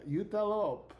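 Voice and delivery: put-on Italian accent, starting high; French-Italian accent. Character asking players to teach her goodness, French-Italian accent. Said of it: "fifty-year-old Italian man".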